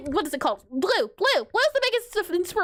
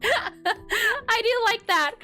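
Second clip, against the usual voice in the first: laughing; 7 semitones higher, much slower than usual. Real, not acted. Laughing at the sad drawing.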